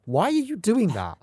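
high, drawn-out question; Bill's exasperated question. Retold or imitated. Fan copying Bill at the monk pyramid, mock bafflement. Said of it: "like the way she says that".

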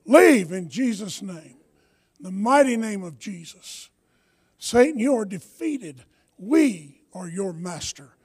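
loud, slow commanding rebuke. Real, not acted. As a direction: cast it out with full force. Commands demons to leave.

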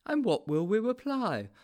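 high, eager sidekick; Sponge, eager. A narrator's reading. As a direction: ask keenly in a high character voice. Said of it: "asked Sponge eagerly".

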